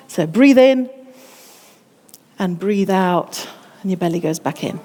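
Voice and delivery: breathing demo. Shown as a demonstration. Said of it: "I'm gonna demonstrate this".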